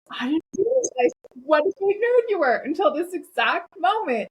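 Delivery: playfully gleeful, building to the last word; excited teasing boss. Retold or imitated. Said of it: "and he goes".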